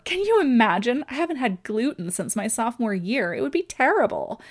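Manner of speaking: high, loud mock horror; hammed-up teen horror; shrieking in horror. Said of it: "The teenager shrieked in horror".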